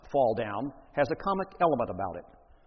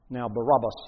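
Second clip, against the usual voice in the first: normal talking voice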